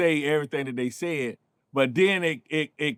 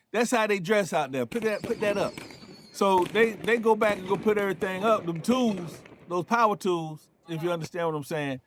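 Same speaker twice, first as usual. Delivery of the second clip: normal talking voice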